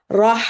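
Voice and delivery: "rah" with a heavy, thick r. Shown as a demonstration. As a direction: say "rah" with the tongue up, no rounding. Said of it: "tell the difference from before".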